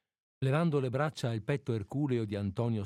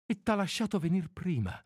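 higher-pitched, anxious question; growing anguish; 8 semitones higher than usual. A narrator's reading. A woman questioning her lover about her husband, growing anguish. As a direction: let the anxiety climb. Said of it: "rispose ella con crescente angoscia".

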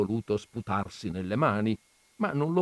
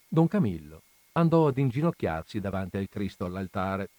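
plain reading voice

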